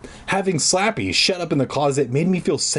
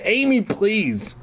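pleading; 6 semitones higher, slower than usual. A narrator's reading. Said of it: "Mom said softly, which Dune didn't".

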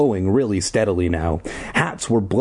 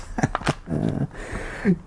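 breathy laugh; 5 semitones higher, 6 dB quieter than usual. Real, not acted. Narrator laughs.

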